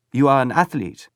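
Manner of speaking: put-on eager boy asking in broken English; German boy. A narrator's reading.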